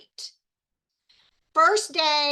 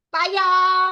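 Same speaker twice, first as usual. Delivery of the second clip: loud, hammed-up Southern drawl goodbye; Southern accent; 4 semitones higher, 8 dB louder than usual. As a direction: stretch "Bye, y'all" in a thick Southern accent. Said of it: "do our Southern accents".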